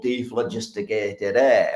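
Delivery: slow, broad Yorkshire pronunciation; Yorkshire accent. Acted. Lecturer quoting a Yorkshire chemist's name for a gas, Yorkshire accent. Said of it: "sounds so much nicer with a Yorkshire accent".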